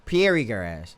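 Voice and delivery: "Pieri" as pee-AIR-ee before grass; tentative wrong "Pieri", pee-AIR-ee; mispronounced prairie. Real, not acted. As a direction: misread "Pieri" with an extra ee after P. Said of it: "I'm saying that wrong".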